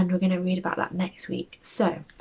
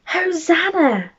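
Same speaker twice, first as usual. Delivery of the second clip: high, slow, drawn-out cheer of the crowd; 9 semitones higher, 5 dB louder, slower than usual. Acted. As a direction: call it out like a joyful crowd. Crowd shouting in story.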